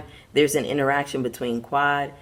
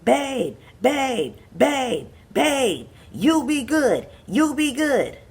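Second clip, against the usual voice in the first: much higher, pressing mock yell of babe; hammed-up husband yelling at his wife; imitating a yell; 8 semitones higher, much slower than usual. Imitating the husband yelling at his wife to behave. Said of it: "Greg starts yelling".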